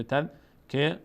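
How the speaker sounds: تذکره slowly in syllables, ending -rah; careful تذکره with no t; pausal h ending. Shown as a demonstration. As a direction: split up تذکره and close on h.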